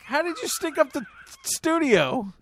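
ordinary talk, nothing marked

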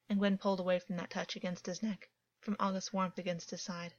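plain reading voice

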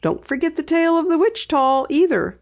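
put-on light girl voice for Penny; Penny, lightly voiced. A narrator's reading. Penny telling her dad she'll drive, lightly voiced girl. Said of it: "Penny reminded him".

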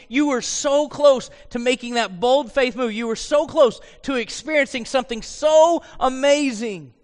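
high, impassioned lament; sorrowful. Acted. Jesus to Peter who nearly made it, impassioned sorrow. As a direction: press the near miss with aching intensity. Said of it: "I think it's sorrow".

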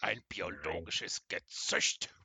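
slow, weighty trailer voice; slow dramatic voice. Pretend. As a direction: intone it like a film trailer. Dramatic movie quote.

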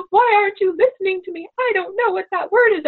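put-on high, fast whiny child protest; frustrated child. Daughter protesting to her mother, whiny and high. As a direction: mimic a fed-up kid pushing back. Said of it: "And she's like".

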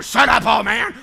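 loud, hammed-up telling-off; mock heckling. Crowd that earlier silenced the beggar, mock heckling. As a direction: imitate an annoyed crowd. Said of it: "A minute ago, they were going".

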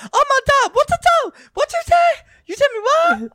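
very high, loud shocked squeal; shocked aunt. Retold or imitated. Aunt shrieking at her nephew's mistake, very high and loud. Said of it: "And she goes".

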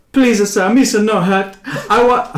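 high, laughing Gungan pleading; squeaky pleading alien, breaking into laughs; weeping Jar Jar. Acted. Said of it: "He sobs uncontrollably".

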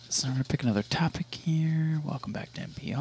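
hushed, soft radio-host murmur; NPR voice. Pretend. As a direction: talk like a quiet public radio host. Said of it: "I don't know why I started talking like that".

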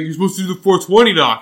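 the stoner lectures me; retold scolding. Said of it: "He's like".

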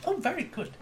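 high, pleased ambassador; a light, approving alien envoy. Acted. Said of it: "says the ambassador Plot".

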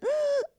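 sharp high mock gasp; startled gasp. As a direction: gasp as if caught off guard. Acting sudden surprise.

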